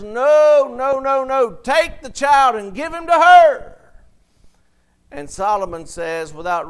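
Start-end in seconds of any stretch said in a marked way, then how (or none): 0.0-3.8 loud pleading cry, pitched up; mother crying out. Retold or imitated. Mother begging the king loudly for her child. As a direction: cry out to save your child. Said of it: "the mother cries out and says".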